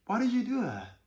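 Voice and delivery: smooth gentle question. Shown as a demonstration. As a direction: ask it gently, inviting an answer. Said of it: "a little smoothness, a little gentleness".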